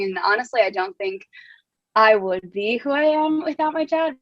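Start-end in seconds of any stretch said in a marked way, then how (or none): 1.9-4.2 real welling up, voice lifting and slowing; moved, nearly crying, then a small apologetic laugh; close to tears. Real, not acted. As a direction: choke up a little while honoring a parent. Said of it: "Wow, I'm gonna cry".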